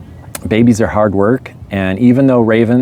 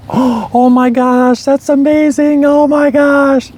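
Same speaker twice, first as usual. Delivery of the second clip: very high, slow, squealing delight; ecstatic; slower than usual. Retold or imitated. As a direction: stretch every word in giddy disbelief. Wife hears she has twins.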